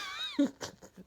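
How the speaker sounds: a high burst of laughter. Real, not acted. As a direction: laugh high at your own joke. Laughing at own story.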